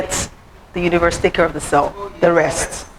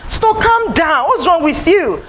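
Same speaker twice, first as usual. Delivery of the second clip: mock scolding; 9 semitones higher, slower than usual. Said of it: "people made me feel like".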